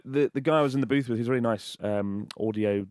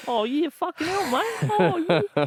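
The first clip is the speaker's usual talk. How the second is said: very high, cartoon Australian; Australian accent. Author doing his Australian impression, very high caricature accent. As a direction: squeal it in a broad Australian accent. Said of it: "your impression of an Australian".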